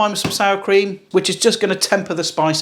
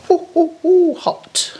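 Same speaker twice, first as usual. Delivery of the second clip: burnt-fingers yelps; 10 semitones higher, slower than usual. Real, not acted. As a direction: yelp like an owl from the heat. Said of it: "I'll just do an impression of an owl then".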